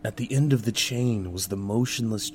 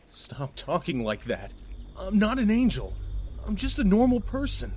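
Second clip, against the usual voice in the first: very high, distressed denial; frantic, high-pitched pleading; panicked protest; 13 semitones higher than usual. Acted. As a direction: begin hushed, then burst out high. Character frantically rejects the worship.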